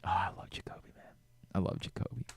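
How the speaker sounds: almost too moved to voice it; real grief for a friend; choked up. Real, not acted. Grieving a friend who died.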